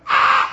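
short mock wail; rehearsed cry. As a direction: try out a fake bawl. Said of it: "I could see them rehearsing emotions".

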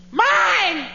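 put-on very high-pitched toddler screech; mock a small child shrieking over a toy; piercingly high, drawn-out possessive yell; yelling three-year-old. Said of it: "this child pulling on something and yelling".

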